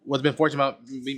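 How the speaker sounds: ordinary talk, nothing marked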